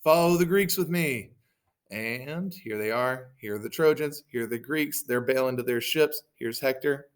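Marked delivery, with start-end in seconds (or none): 0.0-1.3 loud, high battle cry; rallying call. Acted. Hector leads Trojans after Greeks, loud high rallying call. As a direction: call the troops onward, acted. Hector rallies troops to give chase.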